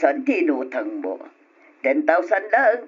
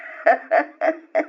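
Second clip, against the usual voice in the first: high smug chuckle after the deal; a loan shark's pleased little laugh; gloating laugh; 5 semitones higher than usual. Acted. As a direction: laugh like you've won. Lender gloats over the deal.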